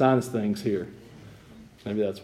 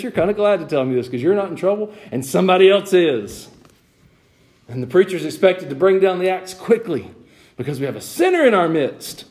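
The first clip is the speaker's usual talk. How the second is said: raised, emphatic pulpit delivery; impassioned preaching; 7 semitones higher, 7 dB louder than usual. Real, not acted. Preacher calling out eager tattlers in church, raised preaching voice. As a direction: press the rebuke on the congregation.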